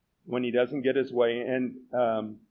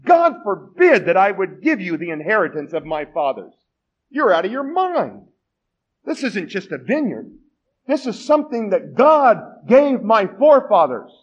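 rising indignant protest, louder and higher in places; 5 semitones higher, 7 dB louder than usual. Retold or imitated. Naboth refusing the king, indignant. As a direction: refuse him with righteous indignation. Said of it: "in an almost indignant way".

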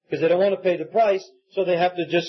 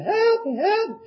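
put-on little child screaming. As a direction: imitate a small child's high-pitched cry for help. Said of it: "this little voice screaming".

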